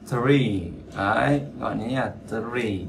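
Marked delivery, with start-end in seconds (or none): 0.1-0.6 "three" with only air on the TH; falling, lengthened "three"; airy TH. Shown as a demonstration. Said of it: "cái chữ thờ này chỉ có gió thôi". 2.3-2.8 "three" with airy TH and long ee; softer, lengthened "three"; drawn-out vowel. Shown as a demonstration. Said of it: "nhớ ngân dài nha".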